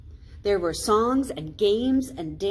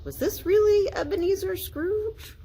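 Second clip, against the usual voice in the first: much higher astonished gossip; high incredulous question; whispered; 14 semitones higher, 4 dB louder than usual. A narrator's reading. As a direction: townsfolk marvelling. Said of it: "whispered to one another".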